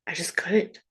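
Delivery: whispered, voice gone; whispering that she just could not; defeated whisper. Real, not acted. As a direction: breathe it out as a whisper. Despair over being stuck.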